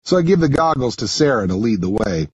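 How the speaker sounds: ordinary delivery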